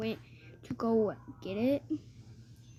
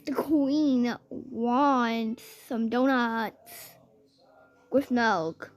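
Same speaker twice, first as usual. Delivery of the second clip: ordinary talk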